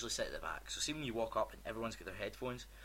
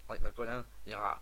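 ordinary talk, nothing marked

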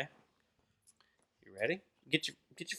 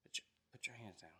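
whispering; 3 semitones lower, 19 dB quieter than usual. Real, not acted. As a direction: hush it under your breath. Dad quietly coaching toddler.

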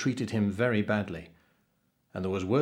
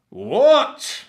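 huge, high, rising roar; 12 dB louder than usual. A narrator's reading. Captain is outraged that the boy spoke, roaring. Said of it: "roared the captain".

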